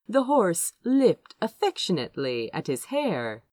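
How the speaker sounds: usual speaking voice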